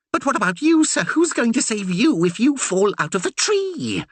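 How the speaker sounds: anxious question. A narrator's reading. The curate anxiously asking the Reverend about falling, anxious. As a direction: fret for his master's safety. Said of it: "Percy questioned anxiously".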